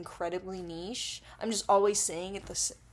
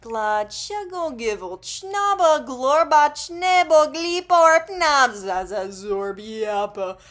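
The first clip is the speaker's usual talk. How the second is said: loud, manic nonsense babble, pitch leaping high; nonsense gibberish; 7 semitones higher, 11 dB louder, slower than usual. Pretend. YouTuber mocking their own weird videos, manic loud gibberish. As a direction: belt out made-up words like a crazed clickbait video. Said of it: "it's just me going".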